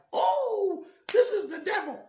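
high, loud panicked cry; terrified cry. Retold or imitated. Seeing the visitor turn into a dragon, high loud panicked cry. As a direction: shriek it in sudden terror.

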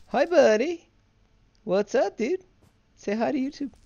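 high, loud, happy hello; excited greeting. Real, not acted. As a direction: greet a buddy with delight. Greeting a friend who appears.

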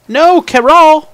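high, loud, drawn-out cry of dismay; dismayed shout. Real, not acted. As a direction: yell as the carrots fall in. Carrot falls into the hole.

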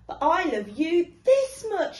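loving parent hare, grandly; big hare outdoing. Acted. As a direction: top the little one with glee.

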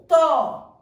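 "Rato" said loud with a strong throaty R at the start; demo of strong R. Shown as a demonstration. Teacher shows pupils the strong R in "Rato", loud and firm. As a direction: hit the opening R of "Rato" hard and loud. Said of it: "veja o som como vai mudar".

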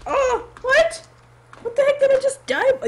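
very high, loud, rushed disbelief; shocked outburst. Real, not acted. Player suddenly killed by something unknown, shocked. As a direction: yell in fast, baffled shock. Dies unexpectedly in game.

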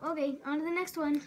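ordinary talk

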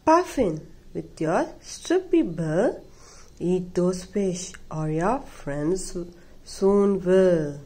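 ordinary delivery, nothing marked

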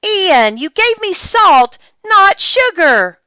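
high, loud, accusing outburst; indignant cry. A narrator's reading. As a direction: scold your brother loudly for his mistake. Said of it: "Olivia cried".

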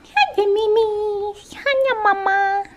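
high, slow sing-song pet voice. Real, not acted. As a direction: coo hello to the cats. Greeting her pets.

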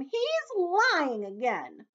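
high, drawn-out shrieked accusation; shrieking. A narrator's reading. Earthworm accusing the centipede, shrieked. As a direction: wail it out high, loud and slow. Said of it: "shrieked the earthworm".

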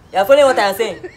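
ordinary talk

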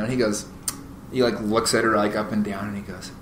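normal talking voice

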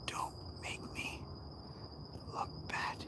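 unvoiced whisper of the same plea; a hushed aside to the camera. Real, not acted. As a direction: breathe it, no voice at all. Whispered repeat of line 34.

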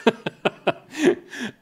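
high chuckle at his own joke; laugh. Real, not acted. Speaker to audience about old photo, amused laugh. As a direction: break into a short, high laugh.

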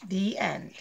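ordinary talk

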